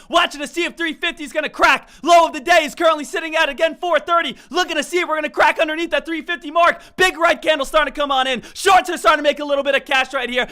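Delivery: from eager to full-throated shouting, then easing off; trading-floor announcer working himself into a roar; building excitement. Real, not acted. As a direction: hype it up like a race caller until you're yelling. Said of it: "I get a little excited every now and then".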